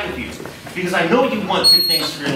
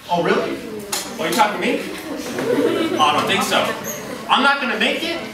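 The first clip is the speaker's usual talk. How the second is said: defiant; 6 semitones higher, faster than usual. Retold or imitated. Defiant reaction to doubters.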